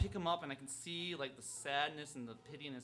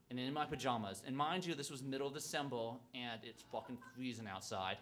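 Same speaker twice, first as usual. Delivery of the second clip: ordinary delivery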